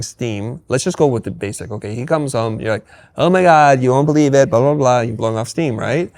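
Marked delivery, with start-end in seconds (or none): 3.2-5.0 bursting out, much louder and higher; excited gush. Retold or imitated. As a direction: gush it all out at once, breathless. Wife gushing about her day.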